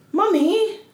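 put-on a light English child's accent calling Mommy; kid's English accent. Mimicking her daughter's Peppa Pig English accent. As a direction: call like a tiny British toddler. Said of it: "so they'll have English accents".

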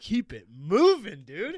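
slow, loud, high urging; drawn-out hype. Real, not acted. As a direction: stretch it out to egg him on. Bidding up the rent figure.

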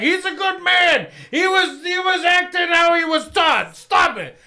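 high, slow mock pleading; whiny mock defender voice. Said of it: "they're like".

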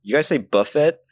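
"buffet" rhyming with "tuffet", T sounded. Shown as a demonstration. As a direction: say "buffet" with a hard T, BUFF-it. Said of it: "when I say buff".

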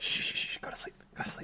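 soft unvoiced lullaby whisper; whispering over a crib. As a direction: hush them to sleep in a whisper. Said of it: "being like".

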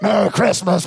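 loud, blaring holiday greeting; unsubtle dump-truck greeting. Acted. Preacher playing a tactless dinner guest, blaring greeting. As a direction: barge in loudly like a truck. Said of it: "with the subtleness of a dump truck".

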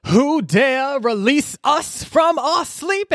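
booming ancient lords, high and slow; slow ominous summons from ancient beings; slow theatrical villain menace. Retold or imitated. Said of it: "They arrive and they're like".

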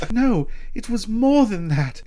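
high, pleading mock heroine; Sheila. Wounded lover insisting to Ash, high mock-pleading voice. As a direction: protest in a high put-on voice.

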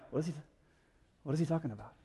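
low, soft acted confusion; puzzled aside. Disciples confused by what Jesus said, puzzled aside. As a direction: murmur it to a fellow disciple, mock baffled.

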